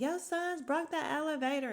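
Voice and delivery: high-pitched mock yell; imitated fussing wife. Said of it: "she starts yelling".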